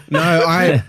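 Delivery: breaking into a teary laugh. Real, not acted. Laughing while he answers about his team. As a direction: laugh it out before the words.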